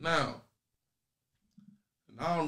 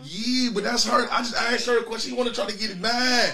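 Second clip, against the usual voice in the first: whiny; 7 semitones higher than usual. Real, not acted. Host complaining to girlfriend.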